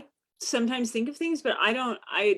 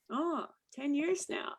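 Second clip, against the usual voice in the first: softer mock of his casual remark; mimicking young model; 3 semitones higher, 9 dB quieter, slower than usual. Author mimicking the cover model's line. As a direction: imitate how he said it, quietly. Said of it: "the way he said it".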